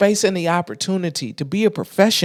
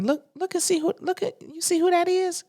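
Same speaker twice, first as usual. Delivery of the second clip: high, softer mimicked voice; mock hushed urging, pitched way up; imitating the therapist; 11 semitones higher, 4 dB quieter than usual. Therapist to her daughter about who's outside, imitated high and soft.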